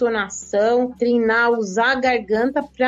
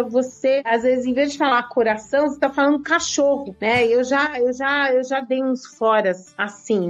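usual speaking voice

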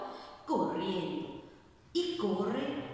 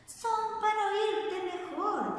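much higher, slow sing-song granny voice; sing-song fake granny; 12 semitones higher, slower than usual. Wolf disguised as grandma answers.

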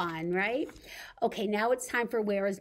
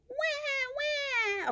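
mock baby cry. Pretend finger babies crying.

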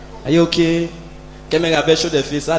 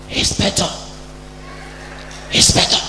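hushed call; 8 dB quieter than usual. Retold or imitated. DPO calling from the back.

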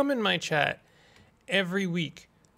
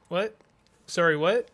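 ordinary talk

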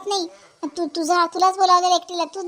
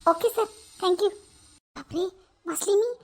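shaken relief; 3 semitones higher than usual. Acted. Relieved after strict boss.

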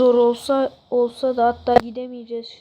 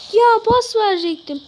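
frustrated; 8 semitones higher, 3 dB louder than usual. Real, not acted. Frustrated at own play.

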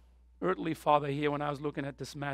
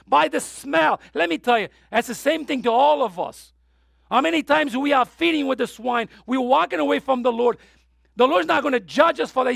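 louder, higher impassioned preaching; preaching at full force; 8 semitones higher, 8 dB louder than usual. Acted. Preacher to congregation describing the prodigal's return, fervent. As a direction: drive the story home with fire.

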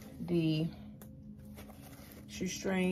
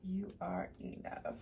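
breathy whisper of the words; 12 dB quieter than usual. Shown as a demonstration. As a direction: whisper it like a secret. Reading the note's imprint.

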